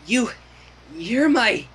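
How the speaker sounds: plain reading voice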